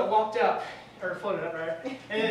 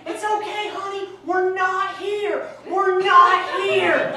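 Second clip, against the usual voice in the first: much higher, slow, loud mock whisper-shout; high-pitched dad; 10 semitones higher, 10 dB louder, much slower than usual. Dad telling son they're not listening, high squeaky shout. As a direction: pretend to back away, hammed up. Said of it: "speaking high-pitched and everything".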